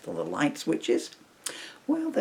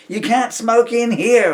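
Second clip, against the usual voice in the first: sudden slow loud yell, higher; 13 semitones higher, 13 dB louder, slower than usual. Retold or imitated. Narrator yelling at Bogart for lighting up, loud. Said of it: "I yelled before I could clamp my tongue".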